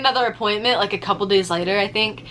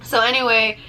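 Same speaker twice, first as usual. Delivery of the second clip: usual speaking voice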